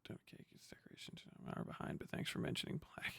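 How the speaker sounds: a quick, breathy whisper under his breath. Real, not acted. Streamer whispering quick replies to chat. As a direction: murmur it so the mic barely catches it.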